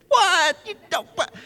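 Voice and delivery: high, loud WHAT, then sputtering; acted-out stunned disbelief; flabbergasted. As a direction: burst out high, then fumble for words. Acted shock at cow pasture.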